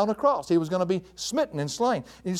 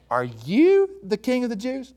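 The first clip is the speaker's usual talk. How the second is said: "you" drawn out and pitched high; emphatic YOU; 5 semitones higher, slower than usual. Shown as a demonstration. Preacher voicing Pilate questioning Jesus, heavy stress on "you". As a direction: lean slowly on "you" as it climbs. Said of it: "is very emphatic".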